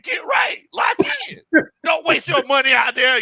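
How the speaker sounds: high-pitched mock yell, no real volume; strained holler at a phone, held back. Friend retells coaching over the phone, mock yelling. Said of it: "yelling at the phone".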